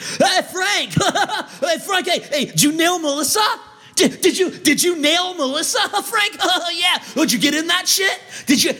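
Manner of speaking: high, laughing, braying bro voice; mock gym bro. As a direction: ham up a loudmouth frat guy teasing his buddy. Mocking loud gym bro.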